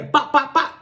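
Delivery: quick, high popping bops; imitated gunshots. Storyteller voices shots through the windows, imitated gunshots. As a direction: pop out three sharp vocal shots.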